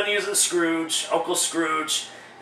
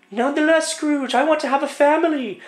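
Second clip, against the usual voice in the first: put-on much higher young woman's voice; falsetto girlfriend; 9 semitones higher than usual. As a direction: plead as the girlfriend in a high voice. Girlfriend pleads with Scrooge.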